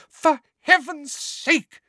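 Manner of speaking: high, slow, furious scream. Acted. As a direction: scream in exasperation. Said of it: "screamed Missus Weasley".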